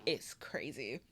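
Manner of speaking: 1 whisper. Real, not acted. Incredulous whisper about her nerve.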